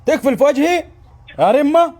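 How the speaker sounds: shouting into the phone; loud, blaring voice; too loud, headphones on. Acted.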